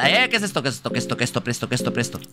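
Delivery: ordinary talk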